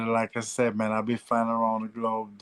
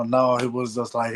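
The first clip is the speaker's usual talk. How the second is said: ordinary delivery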